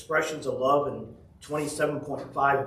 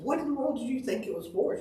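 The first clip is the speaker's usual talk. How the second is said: much higher, mock-astonished "what in the world"; higher, hammed-up fatherly astonishment; incredulous question; 12 semitones higher than usual. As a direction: play up the disbelief of a puzzled dad. Dad retells his incredulous question.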